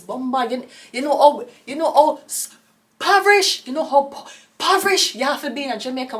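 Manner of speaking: yelled Jamaican exclamation; shouted exclamation. Real, not acted. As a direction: exclaim in disbelief.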